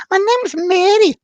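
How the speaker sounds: very high, loud Scottish lady; piping Scottish self-introduction; Mary. Retold or imitated. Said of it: "a Scottish lady".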